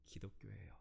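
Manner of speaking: whispered close of the confession; breathy whispered conclusion; hushed ending. Retold or imitated. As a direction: drop to a whisper to finish.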